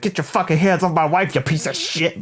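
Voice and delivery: mock angry yell. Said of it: "And he's like".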